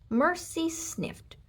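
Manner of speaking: ordinary delivery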